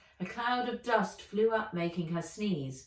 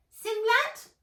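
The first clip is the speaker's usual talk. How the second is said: very high squeaky elf voice; a tiny shopkeeper elf squeaking; 7 dB louder than usual. Acted. A tiny elf calling out to Nat, squeaky and high. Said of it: "said a squeaky voice".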